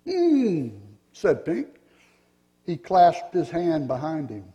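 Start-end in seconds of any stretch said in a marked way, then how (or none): 0.1-0.7 a high doubtful hum; high thoughtful hmm. Acted. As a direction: hum like you are weighing it. Pink thinking aloud.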